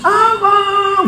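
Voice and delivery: high sung cry of Abang; a hammed-up wife crying at the funeral; mock wail. Preacher mimics a wife crying at her husband's funeral, sung wail.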